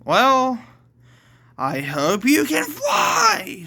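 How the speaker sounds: high, drawn-out villainous taunt; taunting shout. Acted. Alice throws Amber skyward, taunting shout. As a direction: shout it with relish, pitch soaring.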